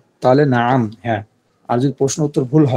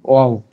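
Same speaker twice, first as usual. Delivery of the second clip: Wow punched louder; emphatic repeat; 4 dB louder than usual. Shown as a demonstration. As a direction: stamp the word wow for the class. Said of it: "এটা কি হলো".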